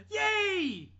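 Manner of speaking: high, loud burst of joy; sudden shout. Retold or imitated. Imitating Gary Busey's random loud yay shout. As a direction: blurt it out like a random cheer. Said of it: "randomly shouting".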